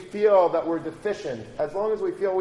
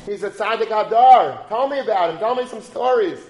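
eager admirer; 4 semitones higher, 7 dB louder than usual. Retold or imitated. Yid enthusing about Chafetz Chaim.

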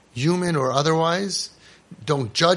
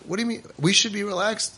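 put-on puzzled kid; 6 semitones higher than usual. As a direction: play the innocent kid who doesn't get it. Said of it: "And they're".

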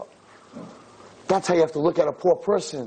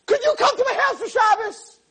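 very high, hammed-up scared pleading; acting terrified to ask, halting then bursting out; mock nervous. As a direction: blurt it out in an exaggerated anxious squeak. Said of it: "'cause I'm so nervous, I'm so scared".

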